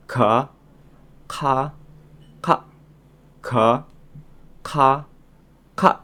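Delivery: ga, ka, ka drilled one by one, the last ka tense; contrasting ga, ka, ka. Shown as a demonstration. Teacher drilling the three Korean consonants for students. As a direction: alternate breathy and tense ka. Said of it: "Jadi kalau diulangi".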